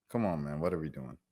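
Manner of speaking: ordinary talk, nothing marked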